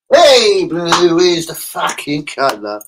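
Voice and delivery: loud, high, slow declaration; loud proclamation. Acted. Fan declaring to his viewers that blue is the colour, loud and slow. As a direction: bellow it like a chant.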